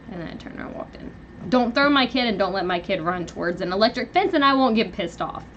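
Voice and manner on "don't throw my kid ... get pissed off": usual speaking voice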